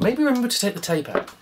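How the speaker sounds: usual speaking voice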